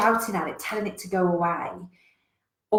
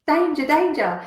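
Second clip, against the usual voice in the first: loud, higher alarm call; alarmed devil; 8 semitones higher, 7 dB louder than usual. Retold or imitated. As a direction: sound the warning. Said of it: "The devil's alerting you".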